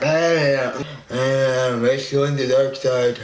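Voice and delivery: loud, garbled tongue-out voice; mock villain tongue with a mind of its own; runaway tongue voice. Tongue rebelling while the dentist pokes, loud silly creature voice.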